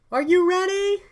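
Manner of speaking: very high crowd-rousing call; hype-man announcement. Pretend. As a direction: whip up a waiting crowd, high and loud. Acting out midnight launch announcement.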